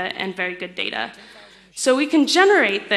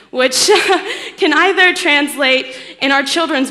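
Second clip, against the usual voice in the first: higher, louder, laughing through the words; 5 semitones higher, 8 dB louder than usual. Real, not acted. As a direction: laugh at your own attempt while carrying on. Laughs at own French attempt.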